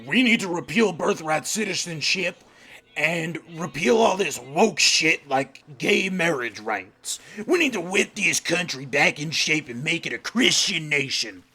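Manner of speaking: lightly put-on voice of a smug right-winger; a hint of a mocking caricature voice; mildly voiced chud caricature; fake chud voice. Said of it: "using a fake voice to depict a person that I don't like".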